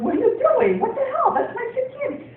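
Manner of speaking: mock-horrified yell, laughing; shocked outburst. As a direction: cry out in outraged shock, laughing. Shocked at guests eating candy.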